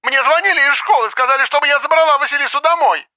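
very high, frantic phone voice; shrill up the explanation in a panic; agitated crying out. A narrator's reading. Mother tells investigator of school's call, frantic cry. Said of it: "Вдруг закричала она".

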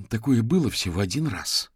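mild protest, a touch embarrassed; object, slightly flustered. A narrator's reading. Ханна answers Юрген's teasing, protesting mildly. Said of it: "запротестовала Ханна, слегка покраснев".

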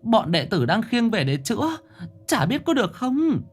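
put-on high, faltering voice of a frightened old woman; stammering old lady. A narrator's reading. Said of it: "miệng bà lắp bắp". (giọng bà lão cao vút, lắp bắp hoảng hốt)

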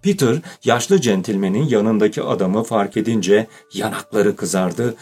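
usual speaking voice

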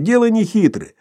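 high-pitched tipsy holding forth; Sharikov, talkative after vodka. A narrator's reading. Sharikov explains his simple scheme after vodka, talkative. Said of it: "становясь словоохотливее после водки".

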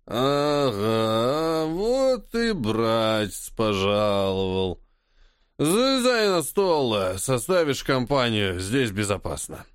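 put-on slow slurred drunken drawl. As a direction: drag the words out tipsy. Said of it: "пьяно икнул и, растягивая слова, пробормотал".

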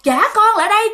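put-on high, loud call to give the child back; desperate shout. Mother chasing the ghost for her kids, high desperate shout. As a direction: yell after the ghost with all you have. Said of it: "mẹ kêu".

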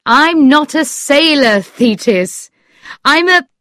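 emphatic storybook outcry; crying out. A narrator's reading. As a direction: exclaim it and break off. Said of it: "Lord Struve cried".

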